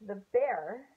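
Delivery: storybook read-aloud voice for a class; gentle story narration to kids; reading to children. A narrator's reading. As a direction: read it like a teacher sharing a picture book. Teacher reads picture book aloud.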